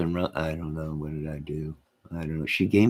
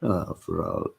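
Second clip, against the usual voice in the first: usual speaking voice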